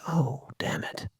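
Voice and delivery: breathy, slow whispered grumble; whispered dog thought. Pretend. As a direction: mutter it under your breath like a nervous dog. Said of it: "and he goes".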